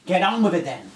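loud, high challenge; defiant dare. Acted. Survivor daring the alien to kill him, loud.

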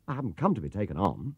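ordinary delivery, nothing marked

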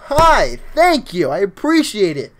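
loud, drawn-out, high-pitched thanks; hyped shout-out. Real, not acted. Streamer thanks a new subscriber, hyped shout-out. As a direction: hype up the new subscriber, stretching the words.

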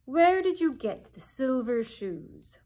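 slow, loud wizard head demand; booming Oz. Acted. The great head questions Dorothy, slow and booming. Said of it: "and then said the voice".

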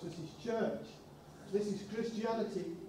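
ordinary talk

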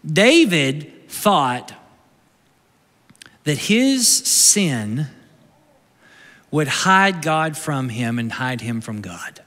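each word drawn out, very slow; slow, grave sermon emphasis; slow and weighty. Acted. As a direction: let the words land one by one. Slow, weighty teaching point.

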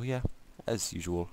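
usual speaking voice

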